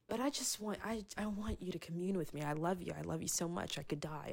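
soft, hushed, tender plea; tender God voice. Retold or imitated. God telling his people he loves them, softly and tenderly. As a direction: speak it gently, like a loving confession. Voicing God's love to people.

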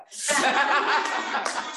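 a loud, high laugh bursting out; burst of laughter. Real, not acted. Telling the room about mom, loud real laugh.

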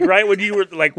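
ordinary delivery

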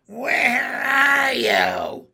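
very drawn-out high witch shout; loud, slow crone call; hag calling out. A narrator's reading. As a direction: screech for your escaped victim. Hag hunting for the frog.

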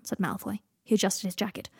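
plain reading voice

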